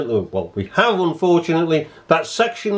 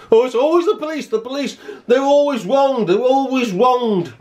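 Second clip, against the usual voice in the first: high, sing-song mimicry of naive people; mocking the public; 8 semitones higher than usual. Mimicking police-admirers, high mocking sing-song. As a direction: sneer at them in a prissy higher voice.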